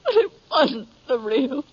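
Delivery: the widow's grief spilling over as she gets to the buckle; breaking into tears. Acted. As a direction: voice cracking into a sob on the last words. Widow breaks down telling the sheriff.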